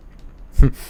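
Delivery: helpless, airy burst of laughter; cracking up at chat, can't hold it in. Real, not acted. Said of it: "I don't know why I'm laughing".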